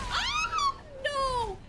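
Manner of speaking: very high, loud wail; high-pitched cry of alarm; panicked scream. Real, not acted. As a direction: scream it in dismay. Things go wrong in game.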